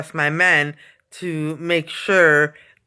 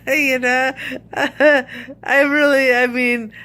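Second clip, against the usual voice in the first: higher voice broken by giggles; laughing; 7 semitones higher, slower than usual. Real, not acted. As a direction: laugh sheepishly through the words. Laughs at own fear.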